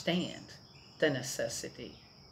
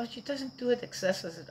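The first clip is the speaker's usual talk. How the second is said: neighbor's reply; 9 semitones higher than usual. Retold or imitated. Neighbor quoted in story.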